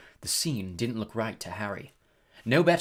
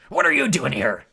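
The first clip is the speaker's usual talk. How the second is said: loud, angry demand; furious; 3 semitones higher, 9 dB louder than usual. A narrator's reading. Hagrid confronts Malfoy in his hut, furious. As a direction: confront an unwelcome intruder. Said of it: "said Hagrid furiously".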